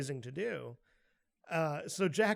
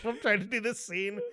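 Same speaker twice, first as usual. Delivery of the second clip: ordinary talk, nothing marked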